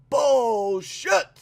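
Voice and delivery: loud, high mock yell; yelled bullshit. Recapping the show, he yells the villain's line loud. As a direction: bark it out like the villain. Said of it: "yells it out".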